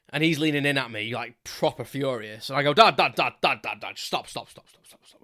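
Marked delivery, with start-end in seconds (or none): 2.7-4.0 put-on drunken calling out. Drunk son interrupting angry dad. 4.0-5.2 put-on drunken whisper. Drunk son hushing his dad.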